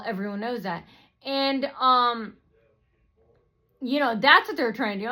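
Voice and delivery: ordinary delivery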